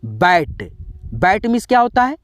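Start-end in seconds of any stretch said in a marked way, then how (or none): 0.0-0.7 बैट with a short open a; bat. Shown as a demonstration. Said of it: "अ करना है".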